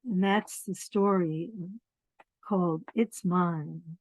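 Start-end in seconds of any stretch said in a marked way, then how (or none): none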